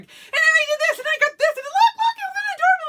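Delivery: squealing high excited gush, very loud and giddy; gushing to husband. Retold or imitated. Showing husband her new stationery, high excited squeal. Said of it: "if y'all could see me when I'm telling my husband".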